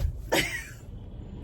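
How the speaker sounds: squeaky airy laugh rising; nervous high giggle; high breathy giggle. Real, not acted. Nervous about bookstore.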